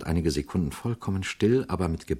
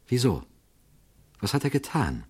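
a little unsure; 4 semitones higher than usual. A narrator's reading. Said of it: "fragte sie ein wenig unsicher".

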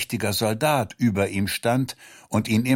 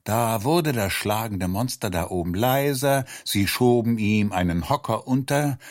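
usual speaking voice

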